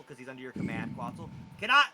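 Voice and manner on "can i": higher, louder anxious goblin; a confused charmed goblin begging for orders. Acted. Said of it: "he looks to [name]".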